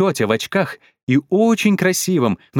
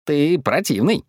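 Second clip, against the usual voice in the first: ordinary delivery, nothing marked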